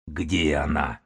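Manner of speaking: low growled demand; growling. A narrator's reading. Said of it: "прорычал он".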